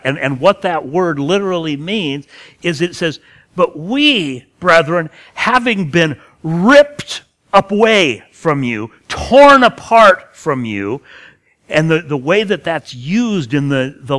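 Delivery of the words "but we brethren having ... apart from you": "ripped away", "torn apart" said louder and slower; anguished emphasis. Shown as a demonstration. Preacher giving the literal meaning, loud and anguished. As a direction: tear the words out with force. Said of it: "not a placid expression".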